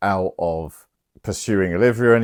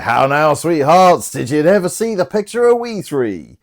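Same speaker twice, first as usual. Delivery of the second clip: high, louder mocking jester greeting; sing-song tease of the fools; teasing jester; 8 semitones higher, 5 dB louder than usual. Fester greets the drunk knights.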